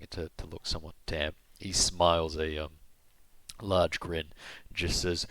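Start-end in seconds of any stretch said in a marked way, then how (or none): none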